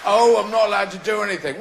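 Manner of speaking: mock whiny God. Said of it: "You know".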